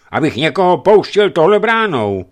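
loud, high, flat refusal; guard shouting no; gruff loud refusal. Acted. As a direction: slam the door on him with words.

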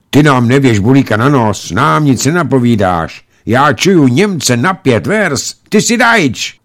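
put-on high, loud child's taunting accusation; loud, high-pitched child challenger; accusing child. A narrator's reading. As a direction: accuse like a suspicious kid. Suspicious children accuse the airman from hiding.

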